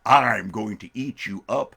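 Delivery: slow, drawn-out menacing bear; big storybook bear, very slow; hungry bear. Acted. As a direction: savour each word like a hungry bear.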